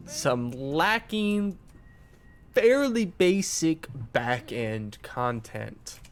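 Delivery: ordinary delivery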